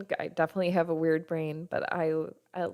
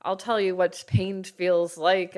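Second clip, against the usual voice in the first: dismissive mockery; 2 semitones higher, 8 dB louder than usual. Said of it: "people in your life who are like".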